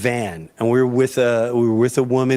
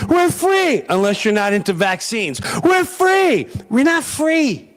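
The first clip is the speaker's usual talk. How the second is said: mock triumphant; 5 semitones higher than usual. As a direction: throw "We're free" up high, mocking the idea. Mocking the claim of freedom.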